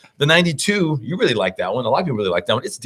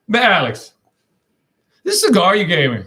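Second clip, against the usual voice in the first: higher, laughing Cajun buddy; lightly Cajun, higher and laughing; Cajun accent; 6 semitones higher than usual. Retold or imitated. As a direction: imitate the Cajun friend, amused and blunt. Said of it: "his accent".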